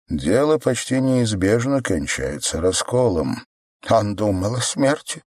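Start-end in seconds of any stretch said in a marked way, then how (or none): none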